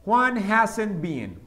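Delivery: Juan hasn't been, stretched out; slow it way down; very slow model. Shown as a demonstration. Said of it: "repeated model".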